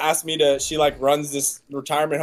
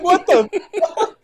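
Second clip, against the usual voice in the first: high and slow, laughing through it; amused disbelief at a price; 11 semitones higher than usual. Retold or imitated. Retelling shock at the price.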